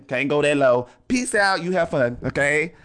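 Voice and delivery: ordinary delivery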